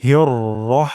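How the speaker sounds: হিয়র রহ with short rounded রহ; correct rah demo. Shown as a demonstration. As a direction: model হিয়র রহ cleanly, no long আ. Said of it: "রাহ কখনোই বলবো না". (সঠিক উচ্চারণ রহ)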